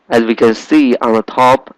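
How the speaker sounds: normal talking voice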